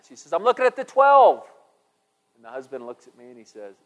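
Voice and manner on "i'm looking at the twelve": much higher, loud woman's voice; high wife voice. Retold or imitated. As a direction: play the wife, high and insistent. Wife answers about clock.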